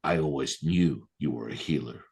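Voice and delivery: low, quiet imitation of the mother; smoking mother. Mother puffing away, to the healer, low and knowing. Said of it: "the mother just starts puffing away. She goes".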